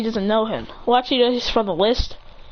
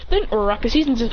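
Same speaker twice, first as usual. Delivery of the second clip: ordinary delivery, nothing marked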